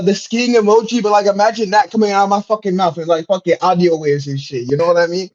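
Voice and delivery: ordinary delivery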